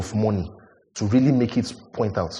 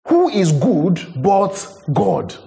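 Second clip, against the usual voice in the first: higher and drawn out very slowly; slow emphatic repeat; 7 semitones higher, much slower than usual. Shown as a demonstration. Preacher repeating the question to his congregation, slow and emphatic. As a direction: stretch every word, let it ring.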